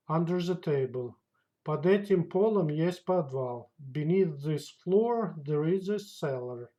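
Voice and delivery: ordinary talk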